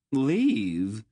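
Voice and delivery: loud, high, rising question; aghast outburst; disbelief. Acted. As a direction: echo it in stunned disbelief. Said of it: "eyes bulged in disbelief".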